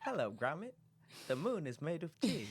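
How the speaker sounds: bad cartoon character impersonation, kept soft; Wallace. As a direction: attempt a hammed-up British cartoon inventor, badly. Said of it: "That was a horrible impersonation".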